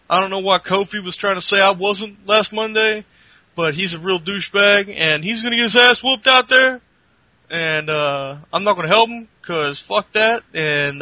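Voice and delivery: loud boastful tough-guy swagger; Titus O'Neil impression. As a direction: hype yourself up like a cocky wrestler on commentary. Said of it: "you sounded like there for a moment".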